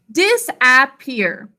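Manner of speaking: "Disappear" slowly as DIS, AP, PEER; sounded out. Shown as a demonstration. Teacher sounds it out with class, "Disappear" slow by syllables. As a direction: split "Disappear" into three full, separate syllables. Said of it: "Sound it out with me".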